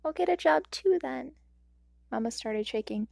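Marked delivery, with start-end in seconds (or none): 0.0-1.3 put-on determined kid. A narrator's reading. Child resolve.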